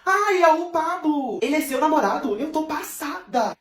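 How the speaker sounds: high, slow, stretched-out exclamations; mock astonishment. Lívia discovers the girl's boyfriend is Pablo, drawn-out amazement. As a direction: gush in exaggerated amazement.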